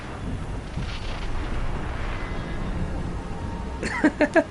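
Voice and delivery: long, soft wheezing laugh; breathy laugh. Real, not acted. Amused by the Dave Chappelle bit, soft wheezy laughing. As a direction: wheeze with quiet, helpless laughter.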